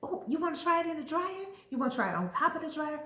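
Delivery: high, quick, game-for-anything questions; eager suggestion. Acted. Acting out an eager Aries suggesting.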